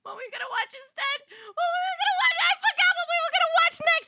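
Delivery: high, loud flustered yelling; flustered shout. Real, not acted. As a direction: cry out lost and flustered. Flustered, forgot topic, shouting.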